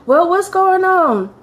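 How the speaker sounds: concerned mother. Retold or imitated. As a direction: imitate a mother pressing slowly for an answer. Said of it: "I remember my mother calling me".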